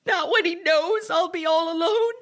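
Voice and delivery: high, tearful cry; sobbing in disbelief; distraught crying. Acted. Distraught wife alone, phoning.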